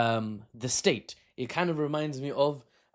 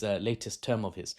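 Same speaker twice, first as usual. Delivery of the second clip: ordinary delivery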